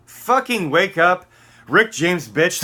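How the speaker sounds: real furious shout. Real, not acted. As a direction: scream it at them to wake them. Yelling at the audience.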